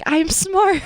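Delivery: mock affirmation. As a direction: parrot her confident mantra. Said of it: "she's like".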